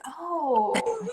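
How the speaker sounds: high, drawn-out oh; mock surprise. Poet reacts to content called delicate, mock surprised. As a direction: feign being taken aback.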